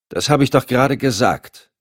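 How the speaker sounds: testy reply to a needless question; mildly exasperated; annoyed. A narrator's reading. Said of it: "High wirkte genervt".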